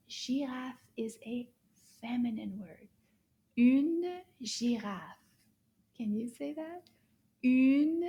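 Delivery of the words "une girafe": slow "Une girafe" with a long, clear "Une"; une stressed. Shown as a demonstration. Teacher to children, slowly modelling "Une girafe". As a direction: stretch "Une" out before "girafe". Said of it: "So this is pronounced une".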